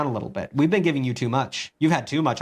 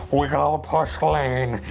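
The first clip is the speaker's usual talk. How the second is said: gamer callout; much slower than usual. Retold or imitated. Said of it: "he'll be like".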